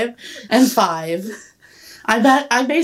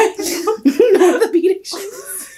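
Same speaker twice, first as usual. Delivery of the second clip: high laughing cry; laughing shout; 10 semitones higher, much slower than usual. Real, not acted. Laughing at orthopedic shoes.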